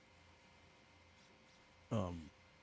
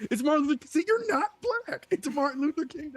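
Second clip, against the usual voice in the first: a much higher, mocking imitation of the online player; mock high teasing; 7 dB louder than usual. Teasing gamer mocking his friend in a much higher voice.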